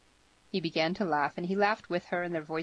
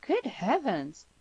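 put-on slow, higher, swooping girl's voice; stretched, pitched-up dismay; appalled exclamation; 6 semitones higher, much slower than usual. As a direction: draw it out in shock. Girl appalled by his resolve.

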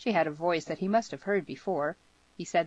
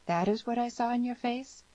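put-on higher, intent girl's question; insistent question in a raised pitch; demanding; 8 semitones higher than usual. A narrator's reading. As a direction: press him for an answer, pitched up. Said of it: "she demanded".

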